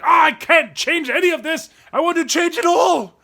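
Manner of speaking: mock frustration; frustrated. A frustrated kid wanting to change everything, mock angry. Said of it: "you're like".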